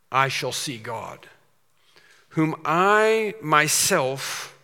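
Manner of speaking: slow, weighty recitation; grave, drawn-out preaching of Job; solemn scripture reading. Acted. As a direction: read it slow like holy writ. Reciting Job's words.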